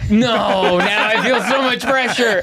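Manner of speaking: high, laughing protest; laughing fluster. Real, not acted. Put on the spot to do an impression, laughing fluster. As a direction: protest helplessly through laughter.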